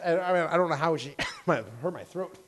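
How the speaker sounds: ordinary delivery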